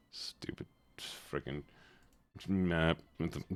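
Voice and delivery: slow, whispered grumble; grumbling under breath. Real, not acted. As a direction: mutter it sourly, barely audible. Grumbling about a smug map.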